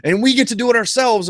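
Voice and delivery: rally the audience in a put-on voice; lightly voiced excited fan; hyped pitch.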